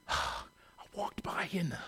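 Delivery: hushed, secretive whisper. Retold or imitated. Stranger leaning in to whisper a prophecy. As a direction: breathe it like a secret.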